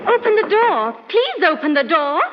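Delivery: loud, high plea at the door; pleading call. Acted. Maid pleads with her mistress to open the door, calling loudly.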